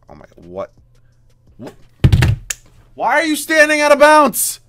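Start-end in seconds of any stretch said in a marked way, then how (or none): 3.0-4.6 loud, high, slow yell of disbelief; exasperated shout. Real, not acted. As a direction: scream the question at him. Player stands out of bounds.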